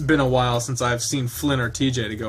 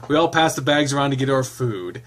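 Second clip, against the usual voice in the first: ordinary delivery, nothing marked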